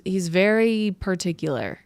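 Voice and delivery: normal talking voice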